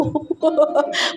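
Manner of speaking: short self-mocking chuckle; laugh. Real, not acted. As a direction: chuckle at your own admission. Laughs at own quarrel. (tawa kecil)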